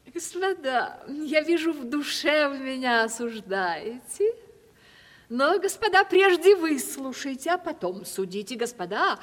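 ordinary delivery

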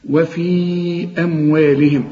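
وفي أموالهم with the long a held for four beats; four-beat madd. Shown as a demonstration. Teacher demonstrates the long vowel, slow recitation. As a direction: stretch the a in أموالهم. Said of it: "أدي مد أربع حركات".

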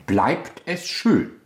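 BLEIBT ES SCHÖN drawn out slowly, falling on SCHÖN; rising question. Shown as a demonstration. Director shows the actress how he butts in, drawn out. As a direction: stretch each word of BLEIBT ES SCHÖN, cutting in. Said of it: "er geht dann rein".

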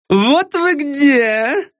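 Lara's high, drawn-out call; high-pitched, slow, showy woman; high female voice. A narrator's reading. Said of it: "прервал их женский голос".

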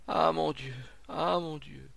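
high, moaned cry of despair; anguished lament. A narrator's reading. Monsieur Rodiguet before the crowd, anguished lament. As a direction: wail it to yourself.

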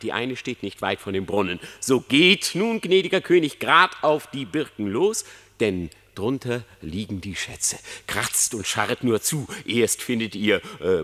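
usual speaking voice

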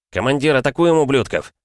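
shouting to attack; pushing for the fight over radio; eager shout. A narrator's reading.

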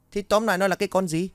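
put-on guard yelling at the stammering boy, fast and loud; frustrated yell. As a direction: yell at him to spit it out.

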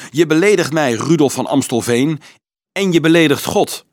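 indignant. A narrator's reading. Priest accuses Dolf angrily.

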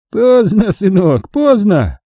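put-on high, snickering old man voice; giggling old man. A narrator's reading. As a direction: giggle it out with cruel glee. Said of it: "хихикнул старик".

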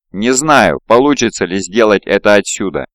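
ordinary delivery, nothing marked

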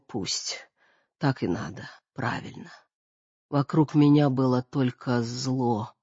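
put-on soft, bitter old woman; weak, resigned, near whisper; frail dying mother.

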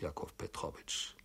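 Jakow Petrowitsch breathed unvoiced; bewildered whisper of the name.

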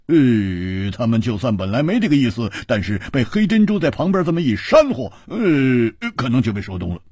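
jovial hero caught off guard; amused swordsman. Acted. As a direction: tease it out while half laughing. 胡铁花 voice again.

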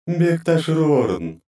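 high, slow, grating otherworldly voice; inhuman goddess voice. Acted. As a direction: scrape it out like chalk on slate. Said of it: "не имел ничего общего с человеческим голосом".

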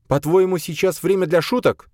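high, indignant question; cross high-pitched rebuke; angry woman. A narrator's reading. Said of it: "произнесла Боя зло".

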